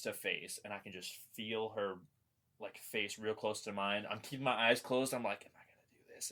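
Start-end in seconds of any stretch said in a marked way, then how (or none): 5.4-6.3 nervous whisper in the dark; hushed self-questioning; whispered doubt. Retold or imitated.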